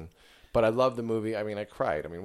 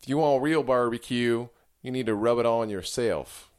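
put-on Texas accent; 3 semitones higher, 6 dB louder than usual. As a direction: advise like a hammed-up Texas pitmaster. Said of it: "Can you do a Texas accent?".

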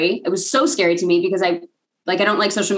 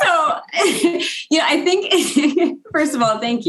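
high voice broken by laughter; laughing while talking; 8 semitones higher, slower than usual. Real, not acted. Guest thanking the host for a compliment, laughing. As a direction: talk through a laugh, letting it settle.

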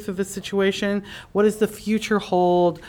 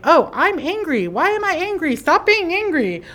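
higher, exasperated self-talk; mock frustration at yourself, pitched up; frustrated inner voice; 8 semitones higher than usual. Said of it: "we tend to say".

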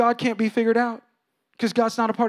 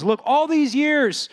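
higher, slow and dragged out; slow whiny brother; 7 semitones higher, 4 dB louder, much slower than usual. Retold or imitated. Preacher voices the older brother whining to his father. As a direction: draw out the grievance. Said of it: "he says".

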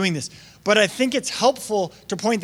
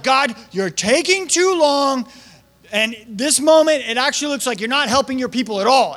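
frustrated; 5 semitones higher, 4 dB louder than usual. Retold or imitated. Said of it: "Moses was like".

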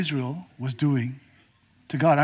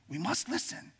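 higher, much softer, almost breathed; hushed repeat; 6 semitones higher, 14 dB quieter than usual. Acted. Preacher hushes the congregation, soft and high. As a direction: let it drop to a hush.

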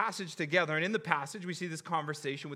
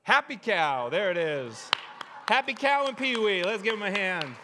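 excited announcing; 6 semitones higher, 7 dB louder than usual. Real, not acted. Introducing the kids to applause.

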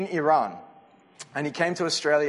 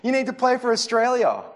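coach calling player over; 9 semitones higher, 5 dB louder than usual. Retold or imitated. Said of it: "He'd be calling Daniel Arzani over to the side and be like".

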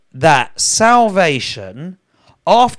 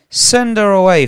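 higher, louder insistent demand; sharp raised cry; urgent plea; 7 semitones higher, 6 dB louder than usual. Acted. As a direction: push them to send her off. Disciples quoted in scripture reading.

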